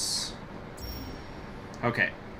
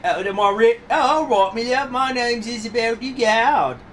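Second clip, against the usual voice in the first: high, loud Cockney witness; Cockney accent; 13 semitones higher, 10 dB louder than usual. Acted. Witness giving her real name to the judge, loud Cockney. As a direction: put on a broad, high-pitched Cockney voice. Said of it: "Oh, this is the Cockney accent here".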